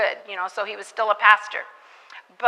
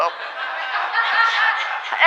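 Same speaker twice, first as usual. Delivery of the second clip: high airy laugh; breathy laugh; 13 semitones higher than usual. Real, not acted. Speaker laughing at how she silenced the drunk leader, airy giggle. Laughing at her own story.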